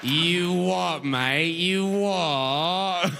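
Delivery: long, drawn-out mock Cockney; hammed-up British vowels, gleefully stretched; mock British accent. Listener mimics a lost British person, hammed-up British accent. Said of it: "They're just making sounds you like".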